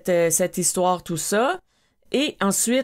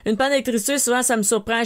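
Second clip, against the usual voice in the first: normal talking voice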